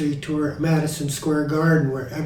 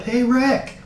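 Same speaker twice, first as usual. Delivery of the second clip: raised, eager phone greeting; Steve calling up; 7 semitones higher, 4 dB louder, slower than usual. Acted. Steve phones his old bandmate Rick, imitated eager greeting. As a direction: call out lightly as an imitated Steve. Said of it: "He calls Rick up".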